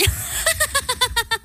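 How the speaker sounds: high-pitched witch's cackle; witchy laugh. Real, not acted. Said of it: "avec ton rire de sorcière". (rire de sorcière aigu)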